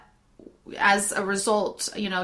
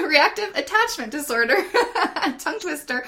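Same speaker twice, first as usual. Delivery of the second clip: higher, laughing through the words; real laughter at tripping over words; laughing at own stumble; 7 semitones higher, 3 dB louder, faster than usual. Real, not acted. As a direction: giggle through a tongue twister.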